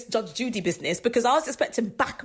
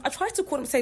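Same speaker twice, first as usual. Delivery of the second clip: ordinary talk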